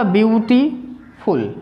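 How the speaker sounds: Beautiful broken in two, a pause before फुल; split into parts. Shown as a demonstration. Teacher showing students the parts of a word, beautiful split in two. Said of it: "यानी beauty एक part है".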